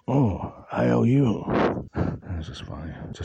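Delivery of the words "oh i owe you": slow, higher-pitched mock servility; acting servile. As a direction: lightly play the servile one, drawn out. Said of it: "just to act as the servile".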